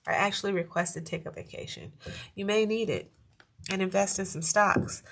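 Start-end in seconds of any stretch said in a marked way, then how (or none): none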